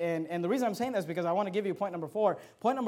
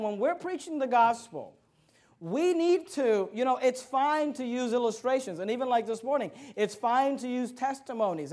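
ordinary delivery

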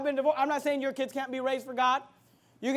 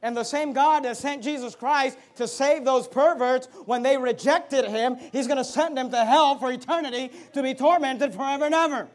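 emphatic pulpit warning; stern, driving preacher delivery; forceful preaching; 5 dB louder than usual. Real, not acted. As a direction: hammer key words to the congregation.

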